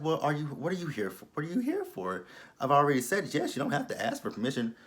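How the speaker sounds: catty imitation of a smug rival, quickening at the end; mocking high voice. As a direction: sneer her words in a prissy pitch. Mocking the woman she dislikes.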